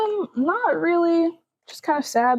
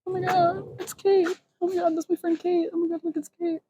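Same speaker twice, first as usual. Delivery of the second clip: breathless, stunned and amazed; gobsmacked; 7 semitones higher, 4 dB quieter, faster than usual. Real, not acted. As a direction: gasp out the surprise. Spots her friend Kate.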